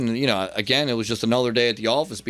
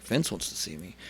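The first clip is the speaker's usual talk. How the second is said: low, much softer, taken aback; hushed disbelief; 5 semitones lower, 10 dB quieter than usual. Retold or imitated. Wrestler told the boss wants him, hushed dread. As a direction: shrink into a small, worried question.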